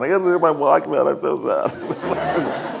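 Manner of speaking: hammed-up tearful wail, drawn out at the end; mock blubbering. Retelling his blubbering past his mom for the Walkman, mock sobbing. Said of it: "And I'm just blubbering".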